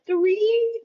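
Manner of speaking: very high and loud, disbelieving; shocked question. Retold or imitated. Hearing of another callback, a high shocked squeal.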